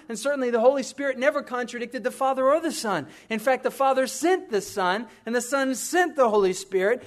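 loud, high, driving sermon voice; preaching at full force. Acted. Pastor preaching unity to the congregation, loud and high. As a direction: preach it out, rising with conviction.

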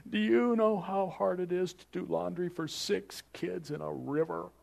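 high, slow whining grumbler voice; a mock-whiny Israelite griping about bread; whiny complainers. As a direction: whine it like a fed-up nagging complainer.

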